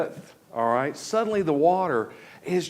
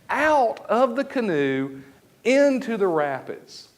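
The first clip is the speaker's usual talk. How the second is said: usual speaking voice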